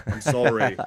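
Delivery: apologetic muddled Irish-Scottish brogue; badly faked Irish lilt; mock Irish accent. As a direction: apologize while staying in the fake Irish accent. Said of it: "I can't distinguish between them".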